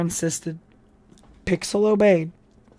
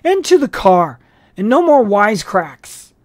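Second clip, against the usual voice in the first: loud higher command; firm order; 3 semitones higher, 7 dB louder than usual. A narrator's reading. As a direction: end the argument now. Policeman giving order.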